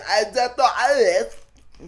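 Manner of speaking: high, loud, slow put-on British; loud high British accent; mock British accent. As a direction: show off a silly British voice. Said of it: "do my British accent".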